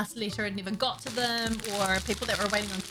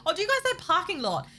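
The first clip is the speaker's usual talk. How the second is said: high, louder amazement; surprised; 9 semitones higher, 5 dB louder than usual. Real, not acted. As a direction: sound genuinely surprised. Surprised at American term.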